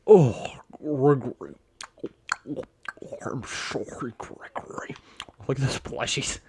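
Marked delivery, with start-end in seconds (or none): 0.1-4.9 drawn-out oh into a whisper; mock apology. Player voicing an apology to Gregory, breathy whisper. Playfully apologising to game character.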